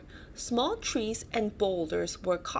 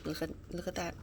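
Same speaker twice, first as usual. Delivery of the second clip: much softer murmured aside; 2 semitones lower, 8 dB quieter, much faster than usual. Real, not acted. Parent showing the picture to the child, soft murmured aside. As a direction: point it out softly.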